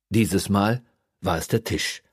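ordinary delivery

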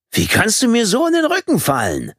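hoch und vorwurfsvoll empört; entrüstet, mit viel höherer Stimme. A narrator's reading. Manne fühlt sich verraten. (empörter, hoher Vorwurf)

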